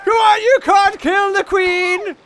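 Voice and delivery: high, loud desperate shout; real shout. Real, not acted. Gamer yelling as the queen gets killed, loud shout. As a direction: yell to save the queen.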